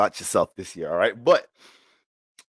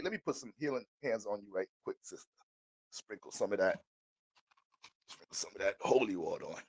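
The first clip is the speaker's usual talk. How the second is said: soft pastor blessing; 4 semitones lower, 13 dB quieter than usual. Acted.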